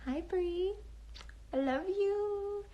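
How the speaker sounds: high, drawn-out, soft greeting; sweet high sing-song hello; sing-song affectionate greeting. Real, not acted. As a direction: coo it high and stretch the vowels.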